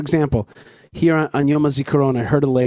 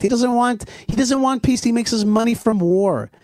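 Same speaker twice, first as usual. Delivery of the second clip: heated; 7 semitones higher than usual. Real, not acted. Denouncing opponent, rising pitch.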